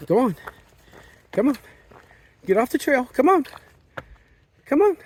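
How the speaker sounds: very high urging calls; coaxing an animal. Real, not acted. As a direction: shoo the deer gently along. Urging deer off trail.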